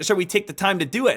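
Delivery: ordinary talk, nothing marked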